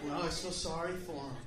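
low, soft, whining excuse; whiny victim voice. Retold or imitated. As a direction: mumble like a self-pitying victim. Audience member whining.

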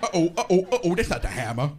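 put-on voice in mock alarm; comic character. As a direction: play the silly warning big. Repeat of the Hammer bit.

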